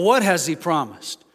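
ordinary delivery

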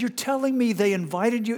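incredulous. Retold or imitated. Pastor retells his shocked reply.

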